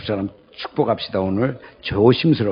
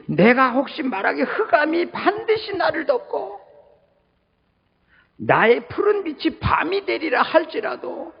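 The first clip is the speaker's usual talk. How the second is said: scripture reading. Acted.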